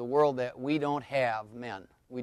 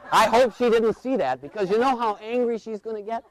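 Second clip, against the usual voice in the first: comic alarm, pitched up; panicked inner kid; 6 semitones higher than usual. Retold or imitated. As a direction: play a kid fearing trouble. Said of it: "My little kid's in there going".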